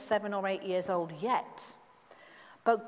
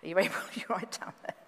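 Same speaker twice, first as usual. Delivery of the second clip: ordinary talk, nothing marked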